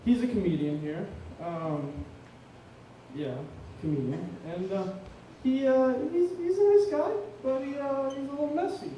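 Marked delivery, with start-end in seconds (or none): none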